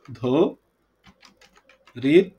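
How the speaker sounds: "ধো", long pause, "রিত"; slow syllable breakdown. Shown as a demonstration. Teacher recapping the split for the class, "ধো রিত" in slow separate syllables. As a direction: set "ধো" and "রিত" far apart. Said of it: "ধীরে ধীরে উচ্চারণ করব".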